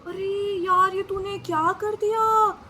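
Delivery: loud, slow exasperated scolding; friend scolding. Retold or imitated. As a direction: scold her for the blunder. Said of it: "बोलते हैं".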